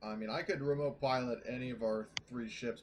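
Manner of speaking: ordinary talk, nothing marked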